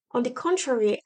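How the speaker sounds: ordinary talk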